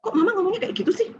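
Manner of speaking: put-on high, fast whiny child voice; a child snapping back at mom, high and quick; annoyed child. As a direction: play the irritated kid talking back, fast and high. Child retorts to scolding mother.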